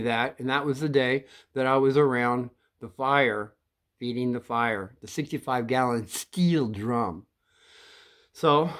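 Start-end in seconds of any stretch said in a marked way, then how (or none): none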